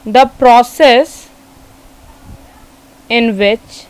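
very slow, word-by-word dictation; slow classroom dictation; dictating a definition. Real, not acted. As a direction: leave long gaps for students to write.

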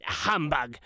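Scrooge voice. A narrator's reading. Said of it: "Scrooge tried to say".

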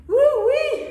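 loud high woo-wee; excited whoop. Real, not acted. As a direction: cheer it out, pumped. Coach cheering after a set.

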